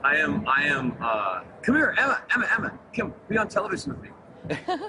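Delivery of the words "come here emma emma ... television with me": raised pitch, beckoning call; calling someone over. Real, not acted. Actor calling his co-star over to join him on television, urgent beckoning. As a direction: call her over eagerly, voice lifted.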